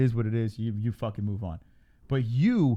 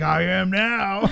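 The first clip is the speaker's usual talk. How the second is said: slow mock voice of girlfriend; 8 semitones higher, 5 dB louder, much slower than usual. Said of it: "And she's like".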